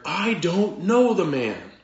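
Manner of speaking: loud, high, slow acted denial; cursing, swearing denial. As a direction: swear it forcefully, stretching the words out. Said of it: "invoke a curse on himself and to swear".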